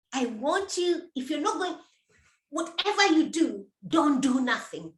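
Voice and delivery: usual speaking voice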